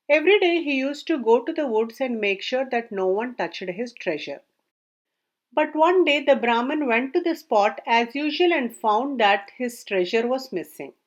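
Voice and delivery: usual speaking voice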